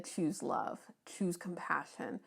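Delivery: drops to a soft whisper on choose love. Real, not acted. Tarot reader whispering advice to earth signs, soft whisper. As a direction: breathe the words out unvoiced, gently.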